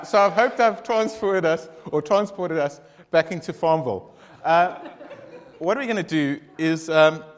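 loud, laughing self-excuse; embarrassed laughing. Real, not acted.

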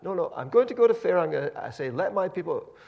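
high, loud eager insistence; Moses insisting. Retold or imitated. Moses insisting to the doubting Israelites, high and loud.

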